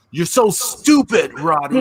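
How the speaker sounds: slow, singsong heel taunt; mock sing-song taunt. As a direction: taunt like a smug villain. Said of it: "And then Adam Cole".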